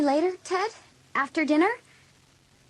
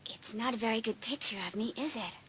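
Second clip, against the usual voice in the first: much softer, coy deflection; coy hush; 3 semitones lower, 12 dB quieter than usual. Acted. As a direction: brush it off softly. Hallie covering, coy.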